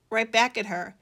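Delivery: usual speaking voice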